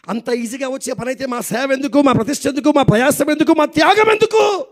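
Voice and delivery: rhetorical questions climbing to a high peak; building indignant challenge. Real, not acted. Preacher challenging the congregation over easy faith, building indignantly.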